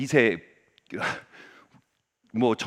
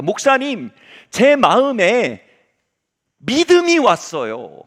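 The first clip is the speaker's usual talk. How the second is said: 느리고 크게 길게 늘여 말하는 청년 흉내; 또박또박 힘주어 청년처럼 고백하기; 청년의 고백 흉내; 5 semitones higher, 8 dB louder, much slower than usual. Retold or imitated. 청년의 고백을 재연. (느리고 우렁차게 청년 말투 재연)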